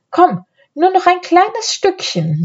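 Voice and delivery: high, light coaxing. A narrator's reading. Anne luring her brother a little further, coaxing. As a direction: lure someone onward, pitch well up. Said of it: "lockte ihn Anne".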